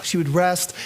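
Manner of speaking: usual speaking voice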